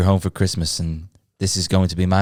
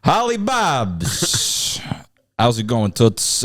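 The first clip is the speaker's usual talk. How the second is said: put-on New York gangster accent; 2 semitones higher, much slower than usual. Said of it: "not a fucking gangster".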